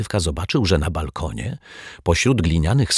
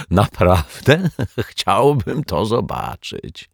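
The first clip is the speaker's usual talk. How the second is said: slow, higher, laugh breaking in; 5 semitones higher, slower than usual. A narrator's reading. Detective snorting at his rival's promise, laughing. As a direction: draw it out through laughter. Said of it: "parsknął śmiechem".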